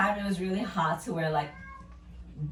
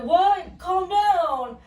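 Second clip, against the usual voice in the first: high, louder mock dismissive dude; mock guy brushing off; 10 semitones higher, 6 dB louder, much slower than usual. Drunk guy brushing off angry woman, high mock dismissal. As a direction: toss it off like he did nothing. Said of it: "And he's like".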